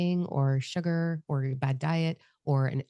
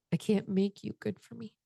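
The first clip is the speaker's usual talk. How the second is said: sad admission; 5 semitones higher, 3 dB quieter than usual. Retold or imitated. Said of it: "And I said".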